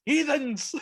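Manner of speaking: hammed-up clerical disdain; mock priest. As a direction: sneer it like a pompous priest. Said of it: "Fucking heathens".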